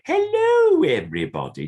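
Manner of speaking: very high, loud squealed hello falling away; big puppet hello. Shown as a demonstration. Puppeteer demonstrating the better puppet greeting to a learner. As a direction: give the right puppet greeting a big lift. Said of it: "I'm going".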